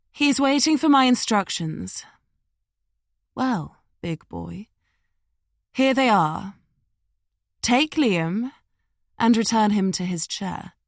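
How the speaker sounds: lazy voice over a walkie-talkie; breathy, sleepy, bored. Acted. Woman on the walkie-talkie orders the guard, sleepy and bored. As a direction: give orders like a dull chore. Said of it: "She sounded breathy, sleepy almost, like this was a boring chore".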